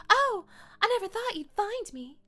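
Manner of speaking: high, loud Southern belle; Southern accent. Acted. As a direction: play her high and Southern. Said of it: "she's a little bit Southern".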